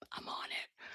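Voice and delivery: breathy whispered aside; whispered reply. Retold or imitated. Whispering to Maza that she's on it. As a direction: whisper it like a secret mission. Retold reply at wing station.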